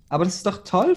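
be glad for her, pitch lifting; delighted. A narrator's reading. Friend cheering the pregnancy news, delighted. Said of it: "freute sie sich".

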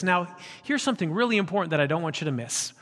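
normal talking voice

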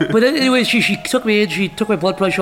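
ordinary talk, nothing marked

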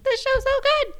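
high squeal of delight; excited gush. Retold or imitated. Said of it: "and I was like".

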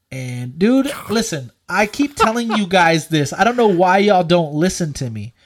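ordinary delivery

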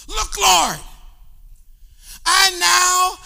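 loud, high, joyful outburst; excited shout. Acted. Zacchaeus shouts to Jesus in excited joy. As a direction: shout it like the moment of your life. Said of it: "it's an exciting moment".

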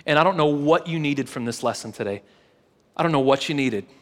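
normal talking voice

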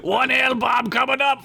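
high hearty dwarf shout; yelling down. Acted. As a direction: call down the shaft to your brother. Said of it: "Fedge yelled down".

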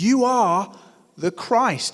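bold declaration. Acted. Peter's bold confession retold.

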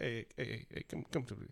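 mock tender coach consoling a player; soft coach voice. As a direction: soothe a grieving player quietly. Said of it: "a coach that'll pull you aside".

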